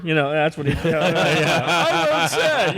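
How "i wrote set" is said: high, drawn-out teasing imitation; slow, high mock boast. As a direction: mimic a colleague's brag, slow and pitched way up. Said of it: "I'm just teasing".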